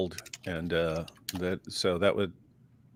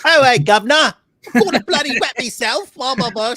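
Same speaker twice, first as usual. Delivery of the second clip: much higher, louder hammed-up cockney accent; mock dumb British accent; 12 semitones higher, 7 dB louder than usual. Mocking the dumbest-sounding British accents to his cohost. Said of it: "Sound like the dumbest".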